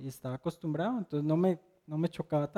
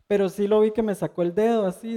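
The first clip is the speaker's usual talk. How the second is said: usual speaking voice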